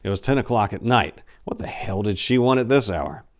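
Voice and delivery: plain reading voice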